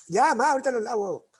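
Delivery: mock whiny son putting off the dishes; whiny child. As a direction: whine it like a kid avoiding chores. Child whining back to mother.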